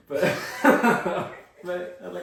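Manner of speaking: laughing. Real, not acted. Speaker recalls reaction to the mail, laughing. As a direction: crack up before your punchline. Cracks up before the punchline.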